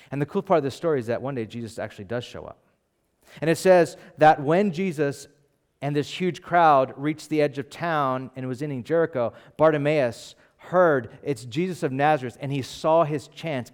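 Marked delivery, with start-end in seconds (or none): none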